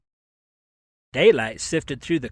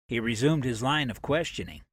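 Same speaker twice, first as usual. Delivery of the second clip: usual speaking voice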